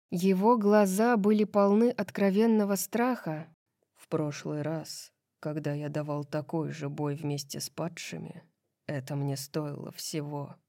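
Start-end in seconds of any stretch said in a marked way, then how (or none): 3.9-10.6 low, soft, hoarse male character. A narrator's reading. Frightened husband to Bryce, recalling his last revolt, hoarsely. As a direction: speak low and quiet, with hoarse restraint. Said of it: "хрипло произнёс он".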